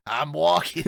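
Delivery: loud, high New Jersey "walking" with a broad aw; Jersey guy showing off his accent. Shown as a demonstration. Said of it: "You guys definitely have that".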